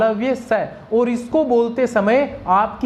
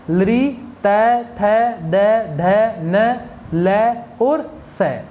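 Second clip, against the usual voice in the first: each sound spaced out very slowly; slow listing; much slower than usual. Shown as a demonstration. Teacher listing the dental letters for students, very slow. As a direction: spell out each letter one at a time. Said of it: "ये सभी कौन से हैं?".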